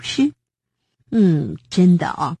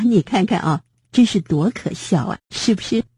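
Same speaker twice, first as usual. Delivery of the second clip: usual speaking voice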